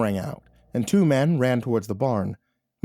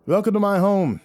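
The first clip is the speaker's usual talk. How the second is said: eager welcome; 10 semitones higher, 5 dB louder than usual. Acted. Host greets guest eagerly.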